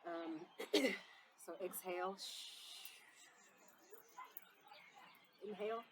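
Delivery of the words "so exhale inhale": guided breathing. Acted.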